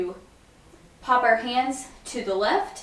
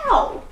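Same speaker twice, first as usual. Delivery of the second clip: sharp pop star "ow", much higher and louder; high "ow" yelp; 13 semitones higher, 5 dB louder than usual. Shown as a demonstration. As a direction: yelp it like the singer. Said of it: "it goes".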